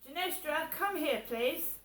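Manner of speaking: put-on loud, high call, talking down to a child; mimicking mum calling her daughter in loudly; bossy mum calling. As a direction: call her in like she's a toddler. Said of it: "I get talked to like I'm two years old".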